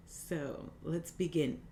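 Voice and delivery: soft, calm breathing guide; guided meditation. Acted. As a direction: gently guide each breath.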